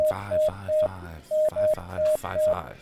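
very high slow beeping; squeaky keypad beeps. Acted. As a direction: chirp each five like a keypad. Mock keypad entry, very high.

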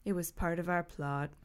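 low, soft confession; hushed, sorrowful admission. A narrator's reading. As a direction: drop the voice, sad and quiet. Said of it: "She lowered her voice sadly".

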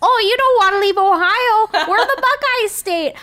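high, loud, hammed-up warning; Midwest preacher voice. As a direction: warn them like a fire-and-brimstone local. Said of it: "They're probably like".

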